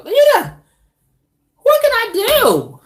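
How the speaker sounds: high, loud helpless plea. Real, not acted. Woman asking viewers what she can do, high plea. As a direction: squeal it like you've given up.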